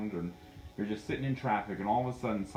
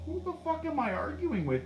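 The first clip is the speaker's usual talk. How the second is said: very high, baffled self-questioning; incredulous realization; 14 semitones higher than usual. Acted. Medium reliving an aha moment, incredulous realization. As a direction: pitch it way up, astonished.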